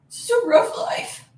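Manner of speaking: high, drawn-out mock pity; slow, babying lament; mock sympathy. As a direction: coo fake sympathy at a pampered dog.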